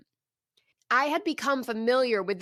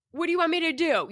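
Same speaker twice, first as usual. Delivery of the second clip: high-pitched exasperated plea; retold protest to God, pitched up; negotiating; 6 semitones higher, faster than usual. Woman bargains with God at her table, pleading. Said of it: "negotiating with God".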